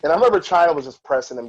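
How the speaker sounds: ordinary talk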